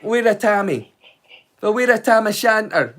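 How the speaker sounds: slow, broad Scottish; lightly hammed Scottish accent; full Scottish. Shown as a demonstration. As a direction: roll it out slowly in thick Scots. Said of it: "go full Scott".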